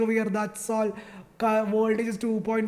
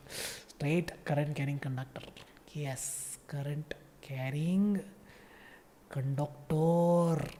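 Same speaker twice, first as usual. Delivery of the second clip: slow, unvoiced whisper of awe; hushed fake reverence for the answer; mock whisper; 6 semitones lower, 7 dB quieter, much slower than usual. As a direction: whisper it as if sacred.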